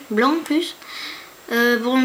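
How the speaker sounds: ordinary talk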